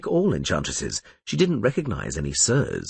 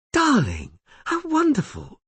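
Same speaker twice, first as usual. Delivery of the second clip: high, gushing false delight; fake joy. A narrator's reading. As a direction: squeal with feigned warmth. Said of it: "displaying such genuine joy".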